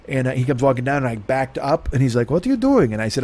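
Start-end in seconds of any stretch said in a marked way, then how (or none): 2.3-2.9 high-pitched mock German accent; German-accented neighbor. Neighbor questions him for backing away, imitated German accent. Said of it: "he sounds like he's German".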